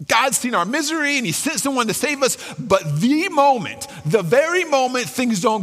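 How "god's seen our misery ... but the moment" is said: normal talking voice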